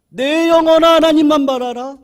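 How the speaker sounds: declared loudly to his own soul; proclaiming to the soul. Shown as a demonstration. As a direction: command your soul with full voice. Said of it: "내 영혼을 향해서 선포하는 거예요".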